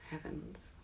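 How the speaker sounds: breathy trailing whisper. Acted. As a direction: let the word fade into a whisper. Repeats word, then whispers it.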